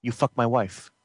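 low, quiet, statement not question; flat menacing demand. Acted. Jealous brother repeats the accusation as a cold statement. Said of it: "End scene".

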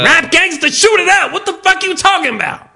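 high, excited gangsta outburst; mock gangsta. Host answering macho talk, mock gangsta tirade. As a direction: burst out as a hammed-up gangster.

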